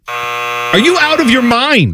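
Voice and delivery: drawn-out, high-pitched disbelief; incredulous outburst. Acted. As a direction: stretch it out, high and exasperated. Outraged at a lopsided trade proposal.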